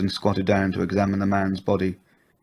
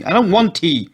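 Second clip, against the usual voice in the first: high, loud, cutting; sharp refusal; 10 semitones higher, 6 dB louder than usual. A narrator's reading. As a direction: snap the refusal at him high. Wife snapping at husband.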